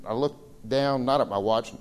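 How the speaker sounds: ordinary delivery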